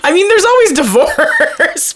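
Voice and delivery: slow, high-pitched laughing delivery; laughing aside. Real, not acted. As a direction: drag it out through real laughter. Joking about the drowning.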